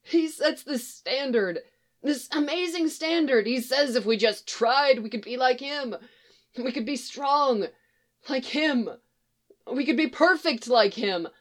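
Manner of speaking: high-pitched, halting plea; pleading young man. Acted. Young man held at gunpoint explains himself, pleading.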